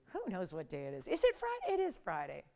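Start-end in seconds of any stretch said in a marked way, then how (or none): 1.1-1.7 much higher, questioning lift; puzzled question. Pretend. Host wonders aloud what day it is, high puzzled question. Mock confusion, asks then answers herself. 1.7-2.4 low, softer falling confirmation; settled answer. Pretend. Host answers her own question about the day, low settled statement.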